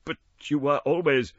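Scrooge's timid, faltering plea. Acted. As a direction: falter nervously. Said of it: "faltered Scrooge".

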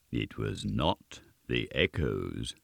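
low, slow and soft; measured, deep contradiction from a goblin. A narrator's reading. Goblin corrects Gabriel Grubb, deep slow voice.

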